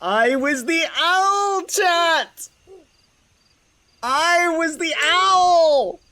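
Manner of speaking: squealing high with delight, drawn out; real delighted squeal; excited. Real, not acted. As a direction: burst out thrilled at the reveal. Streamer reveals her secret cameo.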